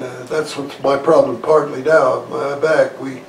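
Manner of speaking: ordinary talk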